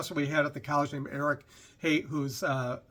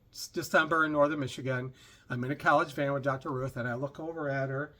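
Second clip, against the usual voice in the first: usual speaking voice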